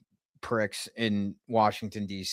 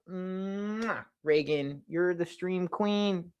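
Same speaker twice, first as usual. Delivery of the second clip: cooing to a pet; 8 semitones higher, 8 dB quieter, much slower than usual. Real, not acted. Streamer doting on the cat, high soft cooing. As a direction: coo slowly and adoringly, pitched up.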